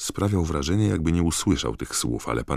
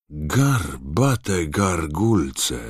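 very drawn-out, higher exclamation; astonished oath; 4 semitones higher, 5 dB louder, much slower than usual. Acted. Bagman spotting trouble among his colleagues, astonished oath. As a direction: stretch it out in a louder put-on voice.

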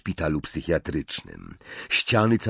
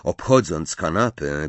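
ordinary delivery, nothing marked

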